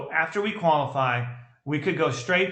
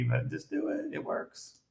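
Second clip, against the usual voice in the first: soft coaxing; 11 semitones higher, 8 dB quieter, slower than usual. Real, not acted. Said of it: "You're gonna get mad at me".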